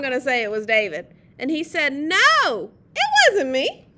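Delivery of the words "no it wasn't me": put-on loud, high little-boy denial; child's denial. A narrator's reading. Reader voices David denying he ate the cake, loud high protest. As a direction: deny it loudly, climbing higher.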